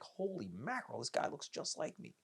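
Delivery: high, soft imitation of amazement; softly acted-out wonder; stunned. Voices birth mother's stunned look.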